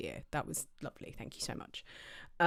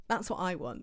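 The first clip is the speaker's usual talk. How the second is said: ordinary talk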